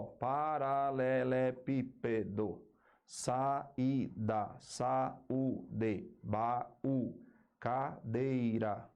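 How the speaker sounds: slow, separated syllables. Shown as a demonstration. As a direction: sound out each syllable as its own emission. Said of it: "cada som é uma sílaba". (sílaba por sílaba, bem devagar)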